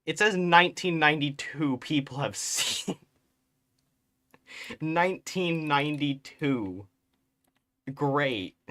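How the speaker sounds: loud, high, laughing amazement; loud, high astonished exclamation with a laugh; amazed. Real, not acted. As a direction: blurt it out in loud disbelief. Stunned by the view count.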